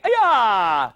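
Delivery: loud drawn-out kiai shout; martial arts yell. Shown as a demonstration. Coach showing kids a jump kick, martial arts yell.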